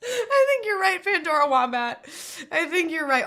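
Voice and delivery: high, loud tearful agreement; crying and laughing. Real, not acted. Streamer answering a chat joke about carbs, tearful and high. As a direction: agree in a teary, laughing wail. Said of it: "crying and laughing".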